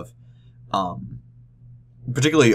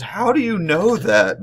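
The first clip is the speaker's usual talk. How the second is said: a higher, slower, baffled question; surprised question; 10 semitones higher, slower than usual. Retold or imitated. Presenter asking the audience guy, higher baffled mock question. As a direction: ask it in mock disbelief. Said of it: "he goes".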